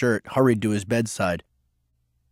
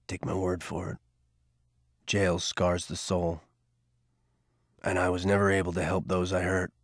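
put-on lower, softer older man, regretful; a hint of a weary older man; Garvey, remorseful; 5 semitones lower, 7 dB quieter than usual. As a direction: confess it quietly, weighed by the past. Garvey's warning.

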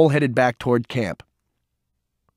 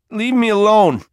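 put-on a hurt boy lashing out, much higher and louder; angry outburst; 10 semitones higher, 6 dB louder than usual. A narrator's reading. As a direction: blurt it out high and loud, pushing him away. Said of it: "Peter blurted".

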